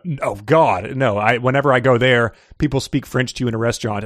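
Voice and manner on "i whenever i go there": normal talking voice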